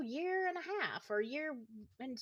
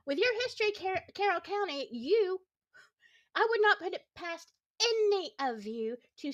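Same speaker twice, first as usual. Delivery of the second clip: louder and higher, jabbing each phrase at them; heated accusation; 6 semitones higher, 4 dB louder than usual. Real, not acted. Streamer accusing the county police of planting evidence, heated. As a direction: call out the sheriff's office with real anger. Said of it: "I'm getting heated".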